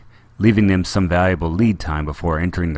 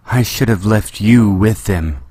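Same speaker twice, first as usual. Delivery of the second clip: slow, louder, seething; trembling anger; 3 semitones higher, 5 dB louder, slower than usual. A narrator's reading. Leia turns on C three P O, trembling with anger. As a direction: bite off each word in fury. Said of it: "her voice trembling with anger".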